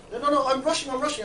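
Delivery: high, loud brush-off; acted hurried refusal. Passer-by refusing a street chat, acted hurried protest. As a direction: brush them off like a passer-by in a rush.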